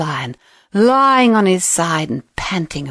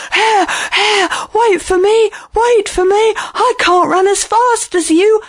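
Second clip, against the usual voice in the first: high, breathless pleading shout; desperate, worn-out lion calling; 10 semitones higher than usual. A narrator's reading. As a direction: cry out after a faster runner. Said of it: "poor old lion shouting out to Hare".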